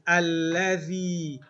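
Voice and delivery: Alladhi drawn out slow and loud; teacher's loud, slow Alladhi; say-along model. Shown as a demonstration. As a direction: stretch Alladhi out for the class to repeat. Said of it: "Let's say this".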